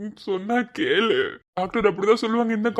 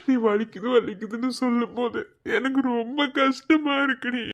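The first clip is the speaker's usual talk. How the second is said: ordinary talk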